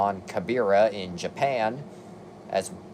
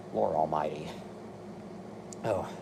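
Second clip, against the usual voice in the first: exasperated groan; 6 semitones lower, 9 dB quieter than usual. Real, not acted. Weary aside at the list.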